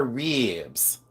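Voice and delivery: RIBS drawn out slowly as "reebs"; mock Southern "reeeebs"; imitated Southern drawl. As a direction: mimic a long Southern "ee" in RIBS. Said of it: "it had three E's".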